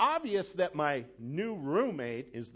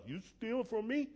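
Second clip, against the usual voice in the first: higher, mock-menacing gangster "You steal from me?"; a hint of a Goodfellas mobster; lightly voiced mobster, menacing; De Niro impression; 8 semitones higher than usual. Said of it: "like Robert De Niro in Goodfellas".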